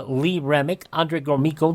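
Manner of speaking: ordinary delivery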